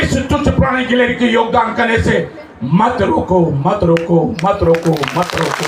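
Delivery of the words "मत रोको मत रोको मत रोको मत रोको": deep, falling entreaty, repeated like a refrain; squirrel's plea in a sunken pitch; low repeated plea. Acted. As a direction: drop low and plead. Squirrel begs not to be stopped.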